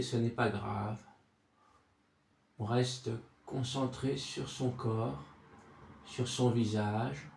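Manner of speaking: soft, low meditation voice with long silences; guided body scan. Acted. Sophrology guide takes listener from forehead to chest, soothing guided relaxation.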